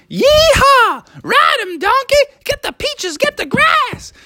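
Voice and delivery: very high whooping cowboy; Froggy riding the donkey shouting; excited yeehaw. Acted. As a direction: whoop like a rodeo rider.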